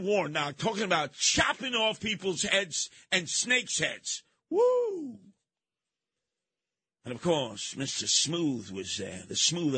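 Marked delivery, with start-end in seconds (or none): 4.5-5.2 mock whoop. Host reacting to the Warnock cuts with a mocking whoop. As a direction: let out a jeering whoop.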